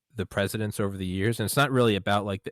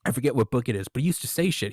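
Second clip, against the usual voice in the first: ordinary delivery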